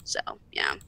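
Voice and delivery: a breathy low so yeah; whispered. Real, not acted. Closing the rant, whispering so yeah. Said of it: "whispered wrap-up".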